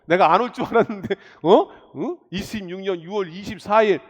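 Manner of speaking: Satan catching a churchgoer, mock surprise; voicing Satan. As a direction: sneer like the devil spotting his target. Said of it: "사단이 여러분들 노려서".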